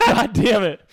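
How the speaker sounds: a loud, fed-up curse at a late-night call; angry curse. Retold or imitated. Woken at two AM for a yeast infection, loud angry curse. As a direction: swear at being woken for nothing. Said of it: "I was so mad".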